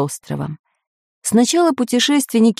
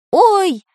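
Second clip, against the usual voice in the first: high startled cry; exclaiming; 13 semitones higher, 4 dB louder than usual. A narrator's reading. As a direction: yelp out in surprise. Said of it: "воскликнул Самоделкин".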